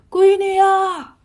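loud, high, drawn-out call. A narrator's reading. Father calls to his locked-up daughter, calling out. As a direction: holler for your daughter, stretching the words. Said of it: "太公就喊".

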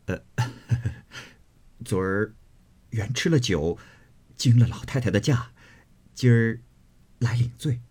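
nervous laugh then meek excuse; apologetic smile. A narrator's reading. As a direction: grandson making excuses to his grandmother. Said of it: "贾琏忙陪笑说".

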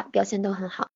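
normal talking voice

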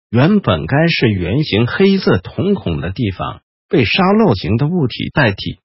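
ordinary delivery, nothing marked

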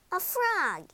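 high-pitched cry of surprise; startled cry. A narrator's reading. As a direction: exclaim it on finding a frog. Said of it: "she cried".

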